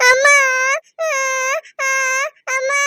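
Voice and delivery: long, put-on child's wails; fake loud crying. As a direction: bawl on cue so it gets heard. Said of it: "நல்லா சத்தமா அழுடி".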